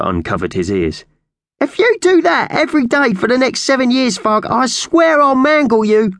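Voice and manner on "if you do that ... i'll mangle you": very high, loud squawking bird voice; angry magpie threat. Acted. Slasher threatens his cellmate.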